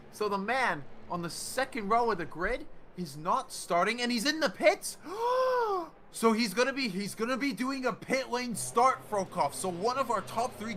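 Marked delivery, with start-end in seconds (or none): 5.0-5.9 gasp. Real, not acted. Driver suddenly in the pits.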